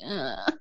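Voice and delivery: low, soft groan of dread; dropped-pitch "ugh"; reluctant groan. Retold or imitated. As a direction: groan under your breath at the thought. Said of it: "And I'm like".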